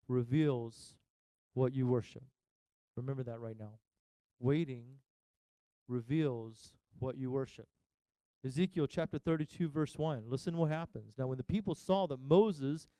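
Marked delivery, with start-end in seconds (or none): none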